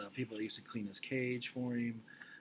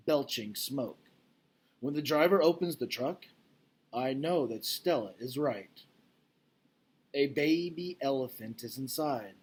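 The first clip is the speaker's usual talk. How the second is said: louder, expressive storybook reading; reading aloud to children; 3 semitones higher, 8 dB louder than usual. Acted. Teacher reading the gorilla novel to her class, expressive. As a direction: read the chapter like a gripping story.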